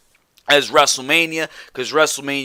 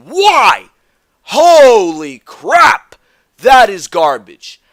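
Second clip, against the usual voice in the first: exasperated outburst; 3 semitones higher, 8 dB louder, much slower than usual. Real, not acted. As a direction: explode in real disgust, stretching the words. Exasperated peak of the rant.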